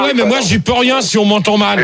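usual speaking voice

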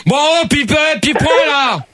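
irritated, high-pitched shout; angry calling-out. Real, not acted. Radio host calling on a prank-named caller, angry calling-out. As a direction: call the caller out, really annoyed. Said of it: "Gérard, doucement quand même".

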